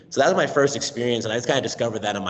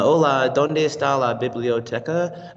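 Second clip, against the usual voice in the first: put-on "¿dónde está la biblioteca?" in a flat, careful classroom accent; stiff learner Spanish; 4 semitones higher, 3 dB louder than usual. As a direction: read it word by word like a shy student. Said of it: "saying the words as I had been doing".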